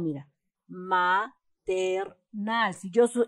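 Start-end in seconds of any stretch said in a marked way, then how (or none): 0.7-2.7 maternal slowly split, ma-ter-nal; slow, separated maternal with the stress last; syllable by syllable. Shown as a demonstration. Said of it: "tónica es nal".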